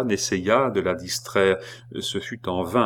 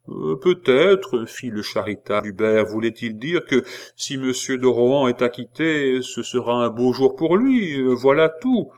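raised, hesitant porter voice with ums; hesitant old concierge; 4 semitones higher, 4 dB louder than usual. Acted. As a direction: Hubert answering the prisoner hesitantly.

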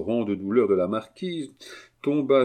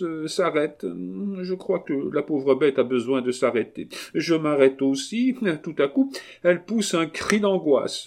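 high, tragic noblewoman; lamenting marquise; 7 semitones higher than usual. Acted. Marquise recounting her dog's death, high and tragic. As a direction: recount it like a tragedy, voice raised. Voices the marquise telling catastrophe.

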